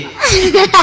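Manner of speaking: very high burst of laughter; cracking up at the punchline. Real, not acted. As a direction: shriek with laughter. Teller laughs at own punchline.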